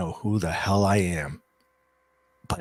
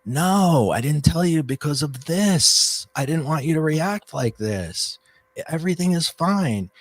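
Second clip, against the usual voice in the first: raised, emphatic retold protest; acted insistence, pitched higher; exasperated reassurance; 6 semitones higher than usual. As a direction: push back firmly at a panicking son. Father answers freaking-out son, retold.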